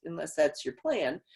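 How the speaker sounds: normal talking voice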